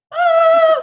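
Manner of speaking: high, loud squeal of delight; delighted shriek. Real, not acted. Thrilled by the news that yawning is contagious, squealing. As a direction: let out a sudden squeal of joy.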